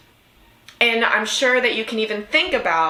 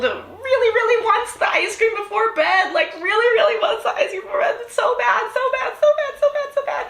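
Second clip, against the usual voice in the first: put-on high laughing kid voice; whiny child voice; 14 semitones higher than usual. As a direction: whine like a kid wanting ice cream. Mimicking the child wanting ice cream.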